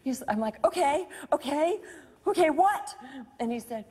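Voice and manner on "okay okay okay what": high, rising anxious acknowledgement; retold flustered, high-pitched prompting. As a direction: humor him urgently, building to a question. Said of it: "we were so discombobulated".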